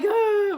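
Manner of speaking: high, loud crying noise; mock sob. Storyteller showing the crying she didn't do, mock wail. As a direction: imitate a wailing burst of tears. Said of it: "Not tears of, like".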